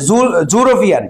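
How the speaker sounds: "জুরোপিয়ান" starting with a J/Z consonant; false start, then "জুরোপিয়ান" with a buzzed first sound. Shown as a demonstration. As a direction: say "জুরোপিয়ান" with "joo" for "you". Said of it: "উচ্চারণটা হয় জ".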